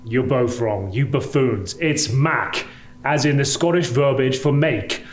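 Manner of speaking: ordinary delivery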